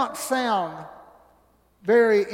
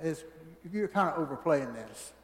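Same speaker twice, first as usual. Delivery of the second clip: skeptical listener; 6 semitones lower, 8 dB quieter, faster than usual. Retold or imitated. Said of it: "you might be sitting there saying".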